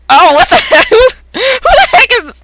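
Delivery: loud, high stammering alarm; startled panic. Acted. Friends seeing something strange appear, in loud startled panic. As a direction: blurt it out, startled and stumbling. Acted panic at a sudden intruder.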